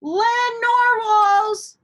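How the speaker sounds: thrilled shout at the sight; excited exclaim. Acted. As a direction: call out in amazement, drawing it out. Kelp excitedly spots unicorns.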